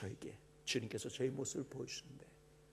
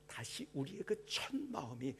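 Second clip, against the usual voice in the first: hushed preaching. Acted. Pastor assuring the congregation God knows them, in a hushed whisper. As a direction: lean in and breathe the words to the flock. Quiet intimate sermon opening.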